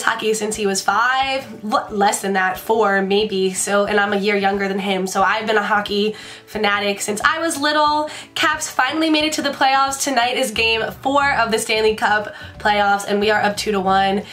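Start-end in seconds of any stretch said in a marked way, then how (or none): none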